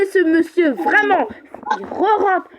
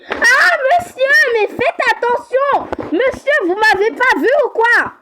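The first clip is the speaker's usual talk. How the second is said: much higher, louder angry yell at a careless driver; angry shout; 9 semitones higher, 4 dB louder than usual. Acted. Nearly hit by a car, she yells high and loud at the driver.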